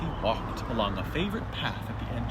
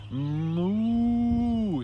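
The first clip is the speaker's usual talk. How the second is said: higher drawn-out moo; loud calf moo; 7 semitones higher than usual. Acted. Young calf mooing at the animals, drawn out. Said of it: "said the calf loudly".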